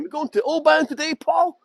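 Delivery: put-on high, playful American tourist accent; mock American accent. Guide imitating an American tourist asking Paul, mock American accent. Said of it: "changed into my American accent".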